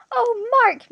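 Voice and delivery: high, loud, acted anguish; sobbing cry. A narrator's reading. Woman kneeling beside her shot friend, anguished sob. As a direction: wail it in grief. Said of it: "Miss sobbed".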